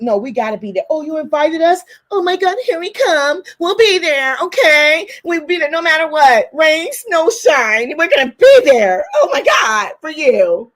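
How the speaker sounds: high, eager and gushing; eager people-pleaser. Retold or imitated. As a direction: gush like you can't wait to show up. Imitating her eager old self.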